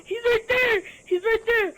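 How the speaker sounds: high loud frantic shouts; panicked shouting. Real, not acted. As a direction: yell in real panic. Killed by monster.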